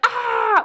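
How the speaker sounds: high, short hammed-up scream with hands on face; mock Home Alone scream. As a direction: squeal like the kid in Home Alone. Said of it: "and he goes, like".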